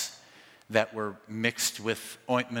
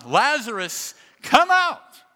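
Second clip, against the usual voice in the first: huge shout, far higher and louder; 13 semitones higher, 9 dB louder, slower than usual. Acted. Jesus calling into the tomb, full shout. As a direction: call the dead out of the tomb. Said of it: "Then Jesus shouted".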